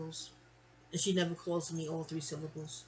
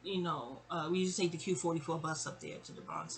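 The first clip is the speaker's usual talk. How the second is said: usual speaking voice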